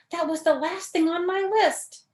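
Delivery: high, loud, excited; shouting. A narrator's reading. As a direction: keep shouting the news. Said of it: "shouted Toad".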